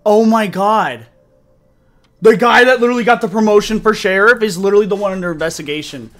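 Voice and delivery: loud, fast, disbelieving; excited outburst. Real, not acted. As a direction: blurt it out in shock.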